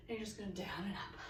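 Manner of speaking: soft breathy instruction; whispered cue. Real, not acted. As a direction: hush it out gently. Coach softly cueing movement.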